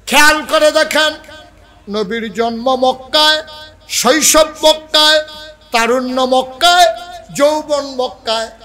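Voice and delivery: loud, high-pitched rhythmic list; ringing preacher's shout; full-force preaching. Real, not acted. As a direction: hammer out each phrase at high pitch.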